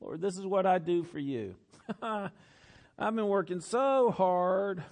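mock whining, words dragged out; whiny voice. Preacher imitating his own prayers to God, put-on whiny voice. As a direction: plead like a whiny complainer. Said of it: "I get my whiny voice on when I talk to God".

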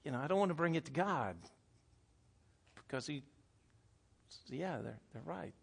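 soft, trailing resignation; defeated. Acted. Person afraid to bring hurt to God, quietly defeated. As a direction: let it sink almost to nothing as you give in.

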